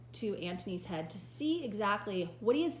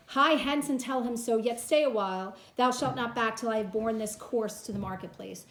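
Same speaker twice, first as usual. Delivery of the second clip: louder commanding stage voice; dramatic reading; 2 semitones higher, 7 dB louder than usual. Acted. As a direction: command the servant to wait. Teacher reads Antony's lines.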